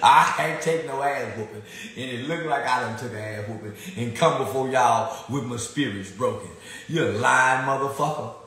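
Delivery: normal talking voice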